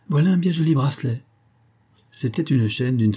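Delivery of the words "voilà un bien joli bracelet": higher, lit-up voice; moved by desire. A narrator's reading. Madeleine spots a bracelet in the shop, eager. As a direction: let the longing slip out. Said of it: "émue par un désir".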